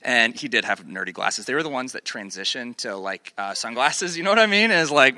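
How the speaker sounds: plain reading voice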